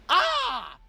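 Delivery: high excited mad-wizard shout; manic sorcerer. Acted. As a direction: greet them like a frantic mad scientist. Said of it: "turns around and looks at you guys and goes".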